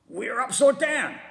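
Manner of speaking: horrified realization; gasped. A narrator's reading. Mister Twit gasping in horror. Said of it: "gasped Mister Twit".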